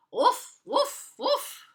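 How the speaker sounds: high mock woof woof; playful high woofs instead of singing; dog barking woofs. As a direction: bark the tune like a dog. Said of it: "All he could do, do was go".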